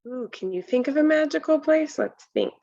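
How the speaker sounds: ordinary delivery, nothing marked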